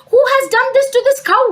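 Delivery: high, loud royal indignation; outraged demand. Acted. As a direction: demand the culprit as an outraged king. King demands the culprit.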